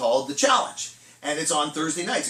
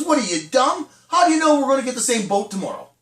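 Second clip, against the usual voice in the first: loud, high, fast comic berating; exasperated scolding; 5 semitones higher, 6 dB louder than usual. Acted. Fisherman berates his buddy for marking the boat, exasperated. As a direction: scold the foolish buddy in one quick rush.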